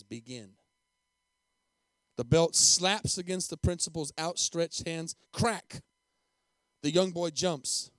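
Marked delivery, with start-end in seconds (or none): 0.0-0.6 low, very soft order; quiet command. A narrator's reading. Principal holding out his own hands to teacher, quiet command. 5.3-5.8 sharp, higher voiced whip crack; belt crack. A narrator's reading. Preacher narrates the first blow, sharp crack.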